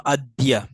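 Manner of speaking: অদ্য said as "ad-di-ya"; pronunciation demo. Shown as a demonstration. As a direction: sound out অদ্য with an audible "i" before the final "ya". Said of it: "এটা উচ্চারণ কি হবে".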